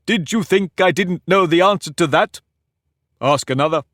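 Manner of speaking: put-on pompous egg lecturing a girl; haughty egg. Humpty mocks the question.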